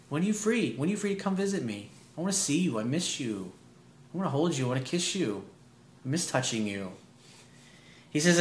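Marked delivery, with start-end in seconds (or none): 0.1-6.9 tender longing. Shown as a demonstration. Coach voicing the man's longing for his girlfriend, soft and tender. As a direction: coax her gently to come visit.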